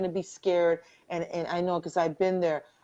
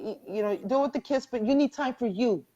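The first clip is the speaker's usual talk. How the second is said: ordinary talk, nothing marked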